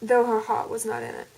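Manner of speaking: reading the fan fiction aloud in an attempted American accent; American accent story reading. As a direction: narrate the chapter in a put-on American accent. Do the entire thing in an American accent.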